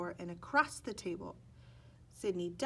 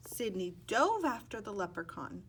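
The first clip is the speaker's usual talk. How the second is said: ordinary delivery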